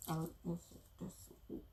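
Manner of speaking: whispered aside to self. Real, not acted.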